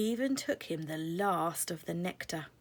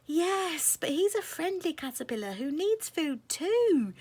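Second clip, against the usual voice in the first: high, pleading fairy voice, louder; 10 semitones higher, 6 dB louder than usual. Acted. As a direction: beg the queen to let him eat. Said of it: "Butterfly Fairy pleaded".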